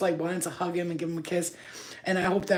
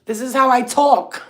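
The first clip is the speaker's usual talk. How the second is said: loud, high, exasperated retold protest; 7 semitones higher, 11 dB louder, slower than usual. Mother telling daughter she isn't yelling, loud protest. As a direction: protest at raised pitch and volume. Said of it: "that's why I talk so loud".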